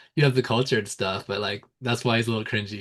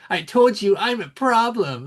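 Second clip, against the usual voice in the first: higher, slow, puffed-up boast; mocking Usman's boast; 8 semitones higher, slower than usual. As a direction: ham up a cocky fighter's brag, slowly. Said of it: "at the end he goes".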